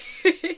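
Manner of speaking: short, high giggle; real giggle. Real, not acted. Podcaster laughing off a tangent about glasses, high giggle. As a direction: giggle after losing the thread. Laughs while losing thread.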